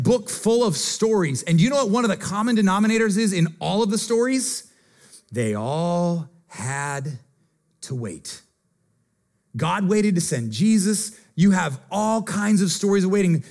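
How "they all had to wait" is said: slow, low, dropping to near silence; dramatic pause. Real, not acted. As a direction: draw out the line, then let it fall. Dramatic sermon emphasis.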